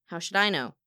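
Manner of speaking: plain reading voice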